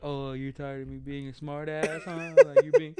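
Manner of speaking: put-on teasing echo of her complaint; joking mockery. Man thinking she's joking teases her, mocking. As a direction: parrot her words back as a joke. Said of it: "me thinking she's joking".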